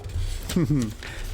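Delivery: a short real laugh, trailing down; amused chuckle. Real, not acted. Reader laughs at chapter cliffhanger.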